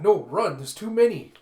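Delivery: high, very loud warning; panicked shout. A narrator's reading. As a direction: yell for them to flee. Panicked warning amid battle.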